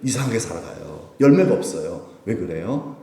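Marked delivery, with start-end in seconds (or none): none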